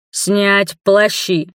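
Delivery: slow, stern order; commanding priest. A narrator's reading. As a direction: bark the command like a zealot. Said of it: "скомандовал Святоша". (властный приказ жреца)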